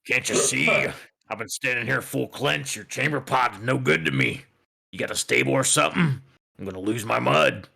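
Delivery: a hint of a rough, crude centaur griping; gravelly centaur voice. GM voices the drunk centaur demanding a stable from the barkeep, lightly gravelly. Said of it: "in just very gravelly voice".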